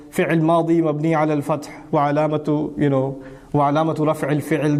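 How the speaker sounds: rattled off fast, like memorised text; rote recitation. Retold or imitated. Student reciting memorised grammar by rote, rushed and fast. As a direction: reel off the grammar text by heart at speed. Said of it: "We just said it like that".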